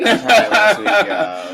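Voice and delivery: talking through real laughter; helpless laugh breaking into the words. Real, not acted. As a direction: crack up while admitting it, getting high and loud. Cracking up at failed imitation.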